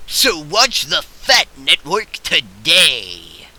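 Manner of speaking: usual speaking voice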